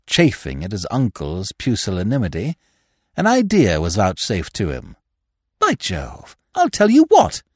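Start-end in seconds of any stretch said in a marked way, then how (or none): 5.6-7.4 high, eager exclamation; sudden high-pitched enthusiasm; excited outburst. A narrator's reading. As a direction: burst out with a bright idea, pitched way up. Sudden idea, told to be quieter.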